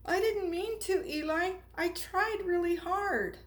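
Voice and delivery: high, earnest plea; pleading apology. Acted. Punchinello pleads with Eli, high and earnest. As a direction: defend yourself, close to tears.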